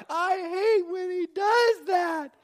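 high, slow falsetto whine; imitated wailing mother. Wife ignores husband's contact-sport explanation, wailing in mimicked falsetto. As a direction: stretch it out like a distressed mom. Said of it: "she would say".

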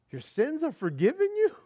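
incredulous question. Retold or imitated. Friends react in disbelief.

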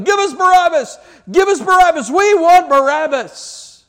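high, loud riotous chant; crowd shouting. Retold or imitated. Riotous crowd demanding Barabbas, shouting. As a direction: roar it like a mob on the verge of riot. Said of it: "they're shouting".

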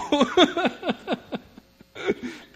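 high, loud, rolling laugh; high laughter. Real, not acted. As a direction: keep laughing it off. Keeps laughing after the story.

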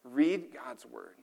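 each word slow and weighted; slow and deliberate. Shown as a demonstration. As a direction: lay down Read God's Word like a command. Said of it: "give us a very practical application".

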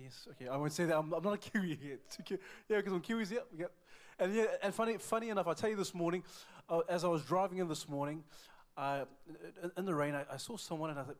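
normal talking voice

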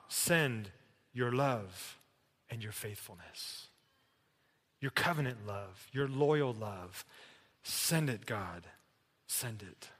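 soft, low prayer in short phrases; hushed plea. Acted. Preacher prays for God's covenant love, hushed pleading. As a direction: plead quietly, letting the voice fall away.

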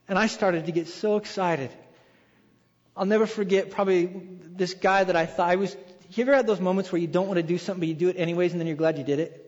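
ordinary talk, nothing marked

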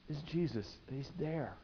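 normal talking voice

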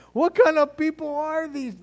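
high-pitched incredulous question; mock disbelief. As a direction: ask it in high, acted bewilderment. Acting the reader's stunned reaction.

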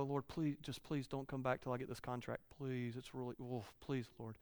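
soft, whiny mock prayer; mock pleading prayer. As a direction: beg God quietly like a selfish child. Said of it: "how many times do we go".

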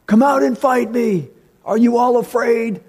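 high, taunting giant's challenge. Acted. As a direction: call out the challenge like a mocking giant. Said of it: "with this giant saying".

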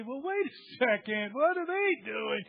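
wife stopping her husband short, mildly incredulous; mock wife. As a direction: play the wife questioning him, a hint of alarm. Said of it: "She would just say".

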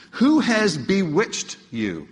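「bewitched」をゆっくり強調する; slow, weighty stress on the whole question. Shown as a demonstration. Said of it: "Look at the word he uses".

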